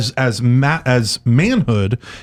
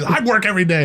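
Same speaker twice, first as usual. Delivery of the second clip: higher, mock-indignant retort; 7 semitones higher, faster than usual. Host retelling his comeback to the priest. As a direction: fire back defensively. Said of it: "And I was like".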